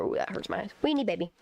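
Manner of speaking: usual speaking voice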